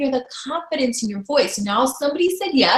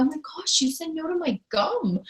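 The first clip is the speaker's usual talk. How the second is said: soft, higher, mildly wounded whine; mock crying; 5 semitones higher, 7 dB quieter than usual. Coach mocking tears over refused gum, mock crying. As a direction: pretend you are hurt by a trivial no. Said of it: "Am I gonna cry?".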